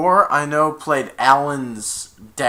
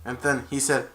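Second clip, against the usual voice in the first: ordinary talk, nothing marked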